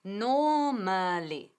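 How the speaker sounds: "Normally" very slow, NOO-ma-lee with silent r; "Normally" drawn out in three syllables, no r; slow model pronunciation. Shown as a demonstration. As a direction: lead a repeat of "Normally", each syllable stretched. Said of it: "Una vez, conmigo".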